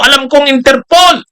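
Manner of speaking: hammed-up shout on Interpol; mock angry. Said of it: "galit na galit si Bato noon".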